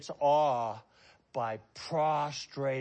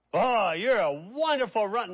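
gushing praise; 6 semitones higher, 7 dB louder than usual. Retold or imitated. Acted interviewer praising athlete.

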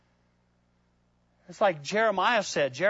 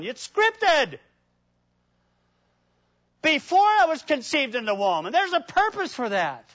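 higher and louder, climbing each decade; rising preached build; 6 semitones higher, 3 dB louder than usual. Acted. Preacher building to the congregation about God's plan. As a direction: build it like a sermon peak.

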